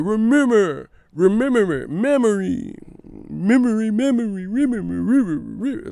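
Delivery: high, loud tumbling repeats; Tim Bradley, stuck stammer. Retold or imitated. Imitating a commentator struggling for Ramirez, high stutter. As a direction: keep botching the word, letting it fade out. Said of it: "He's like".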